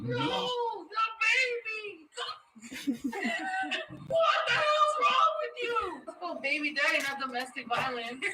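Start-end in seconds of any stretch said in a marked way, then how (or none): 0.1-5.8 high, crying wail of fake horror; hammed-up panic, voice cracking upward; overacted dismay, pleading and crying out; mock anguish. Mock distress over the baby.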